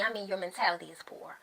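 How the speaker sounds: whispered aside. Real, not acted. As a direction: lean in and whisper the jab. Aside clarifying an insult.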